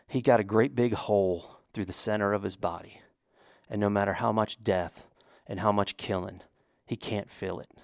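put-on a hushed Southern accent that fades toward the end, as a dying gunfighter; quiet Western accent, lightly put on. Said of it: "I'm gonna use an accent".

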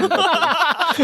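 said through a laugh, slowly; teasing, laughing as he names them. Real, not acted. Teasing guest, laughing mid-line.

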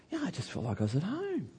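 high, soft and quick; a soft confiding rush; gentle whispery testimony; hushed. Retold or imitated. Visitors quoted saying.

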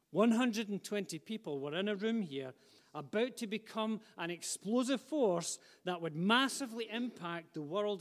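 impassioned sermon to the congregation; fervent preaching. Acted. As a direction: preach it with rising fire. Preacher building to full force.

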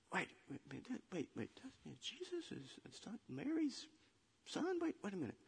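very soft, halting, puzzled mumble; mumbling under breath. Acted. Crowd puzzles over Mary's son, mumbling under the breath. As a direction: mumble it to each other in disbelief. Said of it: "They were mumbling under the breath".

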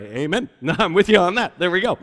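hammed-up amen from the pews; mock amen. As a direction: shout it back like an eager listener. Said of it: "you're like".

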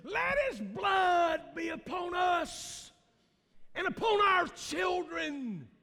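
high, loud, slow shout of the people; crowd cry. Acted. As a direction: hurl the curse like a frenzied mob. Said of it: "The people cried back".

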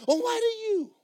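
squeaky high put-on voice; mock high voice. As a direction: pipe it up in a silly falsetto. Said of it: "You go".

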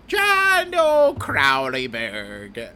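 slow, drawn-out mock Irish name; fake Irish accent. [name] reading the credits in a mock Irish accent. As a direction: stretch the name in a silly brogue.

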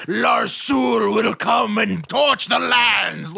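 hammed-up dark-lord proclamation; wizard bad guy. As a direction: proclaim doom like an evil wizard. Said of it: "That sounds like a wizard bad guy's name".

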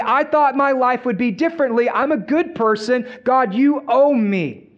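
high-pitched, indignant acted protest; entitled complaint. Jonah listing his service to God, acted aggrieved and entitled. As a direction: plead your record like God owes you. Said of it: "God has become indebted or entitled".

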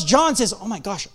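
ordinary talk

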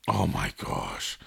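very low, slow, drawn-out groan; deep groan. Real, not acted. Podcaster groaning at a silly acronym, low and slow. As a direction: drop the voice into a low dismayed groan.

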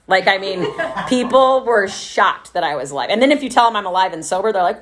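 ordinary delivery

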